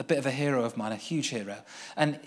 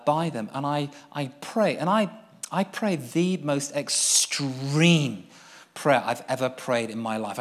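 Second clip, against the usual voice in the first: ordinary delivery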